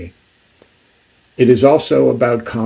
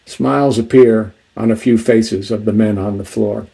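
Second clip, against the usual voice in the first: ordinary talk, nothing marked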